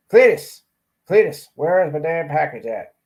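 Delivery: loud call across the room; mock shout for the postmaster. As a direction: yell for Cletus to bring the package. Yelling for Cletus about package.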